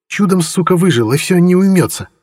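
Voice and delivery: high, indignant outburst; indignant girl. A narrator's reading. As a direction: spit out her indignation, high. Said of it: "негодует Орхи". (возмущённо, высоко)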